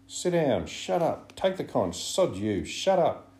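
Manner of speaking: high, loud volley of heckles; angry shouting. A narrator's reading. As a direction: shout them down in short bursts. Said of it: "the storm broke".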